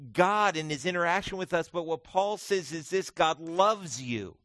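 loud, high preaching voice. Real, not acted. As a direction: preach it out to the back row. Preaching at full force.

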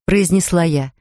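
usual speaking voice

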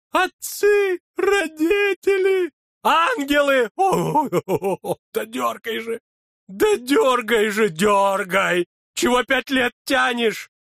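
very high, loud pained cries; shouting in pain; agonized yell. A narrator's reading. Дьячок кричит от боли. (крик от боли)

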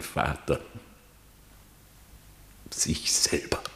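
hushed, unvoiced whisper of a painful secret; whispered shame. Reineke confessing to the king, whispering. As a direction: breathe the words out in shame.

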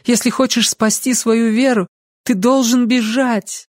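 high-pitched, earnest warning; urging young woman. A narrator's reading. Lucy telling Ansel to flee, urgent.

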